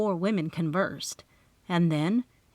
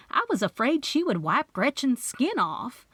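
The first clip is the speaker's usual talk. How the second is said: usual speaking voice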